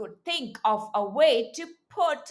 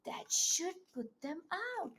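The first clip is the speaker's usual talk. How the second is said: hushed coaxing; 3 semitones higher, 11 dB quieter, faster than usual. Acted. Soft coaxing to turn page.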